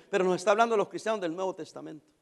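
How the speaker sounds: ordinary talk, nothing marked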